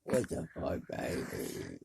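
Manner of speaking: normal talking voice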